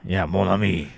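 a light French-Cajun lilt; lightly Cajun-accented French greeting. Acted. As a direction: toss off mon ami like a Cajun. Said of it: "you actually do it better than me".

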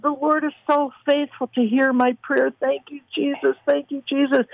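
high, sustained pitch as if moved to tears; imitated tearful gratitude. Friend in Africa thanking the Lord for answered prayer, imitated tearful gratitude. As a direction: quote her grateful prayer, voice held high. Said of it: "she just fell in a puddle of tears and just sobbing and weeping".

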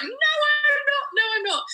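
drawn-out high wail of denial; high, slow denial. Retold or imitated. Refusing to believe the doctor, a high slow wail. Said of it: "And I was like".

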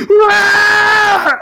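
high, loud roaring "rah" yell; imitated yell. Schlubby guy walks in and starts yelling, imitated yell. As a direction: mimic the man's wordless yell at full voice. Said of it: "he starts yelling".

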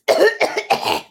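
sudden coughing fit; coughing, choked on water; choking on a drink. Real, not acted. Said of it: "Just choking".

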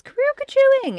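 high hooting imitation; mimicked silly call. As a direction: sing out the call in mockery.

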